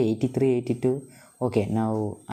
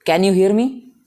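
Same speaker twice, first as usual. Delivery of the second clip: loud and high, checking the line; calling out; 9 semitones higher, 9 dB louder than usual. Real, not acted. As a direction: call out to be heard on the call. Calling into watch on call.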